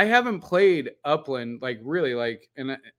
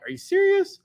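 much higher, squeaky disbelief; mock outrage, voice jumping way up; a squeaky imitated are you serious; incredulous squeak. Her disbelieving reply retold.

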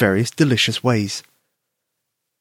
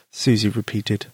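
ordinary delivery

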